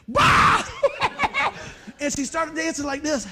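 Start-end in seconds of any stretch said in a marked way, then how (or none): 0.1-0.6 loud high startled yelp; sudden mock cry out. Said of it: "You're going". 0.7-2.0 high hooting laugh; shouting laugh; loud burst of laughter. Real, not acted. Recalling the teacher's mishap.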